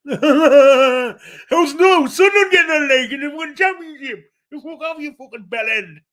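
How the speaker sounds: high-pitched furious yelling; swearing tirade. Acted. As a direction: scream the insults at the top of your lungs. Ranting about Sunderland and the ref.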